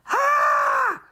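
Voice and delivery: loud, high-pitched comic scream; scientists' scream. Acted. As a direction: scream like a roomful of panicked scientists. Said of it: "all the scientists said".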